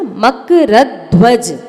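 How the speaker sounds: मकरध्वज said slowly, leaning on the half ध of ध्वज; slow मकरध्वज, weight on the conjunct ध्व; मकरध्वज with the half ध stressed, not said twice; half dha stressed. Shown as a demonstration. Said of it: "तो आधे धा पर जोर देंगे".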